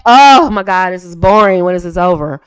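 loud, groaning mock boredom; hammed-up bored complaint; exasperated boredom. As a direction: play a listener fed up with a dull talker. Voicing a bored listener's complaint.

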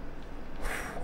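very soft, breathy exhale; nervous sigh. Real, not acted. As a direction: let the breath out quietly. Nervous in dark forest game.

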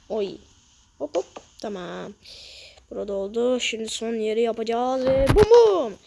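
usual speaking voice

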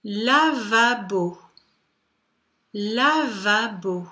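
"Lavabo" drawn out slowly, every syllable distinct; "Lavabo" in slow, clearly stretched syllables; slow dictation. Shown as a demonstration. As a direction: dictate "Lavabo" at half speed for young pupils. Teacher dictates words for syllable counting.

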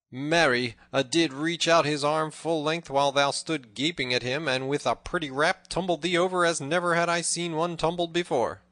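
high, loud, sustained jibe; loud teasing. A narrator's reading. Robin mocks tumbled Little John, loud teasing. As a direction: ring it out, relishing his defeat.

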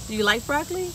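normal talking voice

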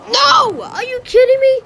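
very high, incredulous protest; outraged disbelief. Real, not acted. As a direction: squeal in disbelief at the unfair result. Player disputes game's split.

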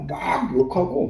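plain reading voice

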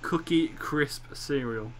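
"Cookie Crisp cereal" spaced out slowly; slow repeat. Real, not acted. Repeating the cereal name for a puzzled friend, slowly. Repeats after "I'm sorry, what?.

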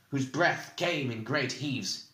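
usual speaking voice

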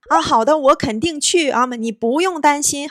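high, loud acted promise to a friend; keen, emphatic acceptance; eager reassurance. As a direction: agree emphatically, voice lifted. Eager reply accepting a friend's invitation.

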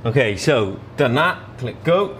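normal talking voice

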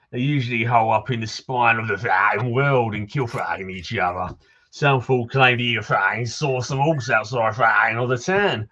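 put-on townsman in a Cockney accent, gruff and chatty; grumbling Cockney local greeting a stranger; Cockney NPC. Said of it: "try and sound a Cockney".

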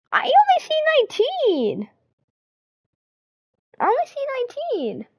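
very high-pitched whine; squeaky protest. Real, not acted. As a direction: squeal it in disbelief. Protesting the missing twentieth viewer.

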